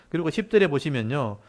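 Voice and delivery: usual speaking voice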